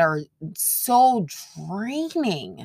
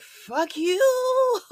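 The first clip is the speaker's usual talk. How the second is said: mock cursing; 14 semitones higher than usual. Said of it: "I'll be like".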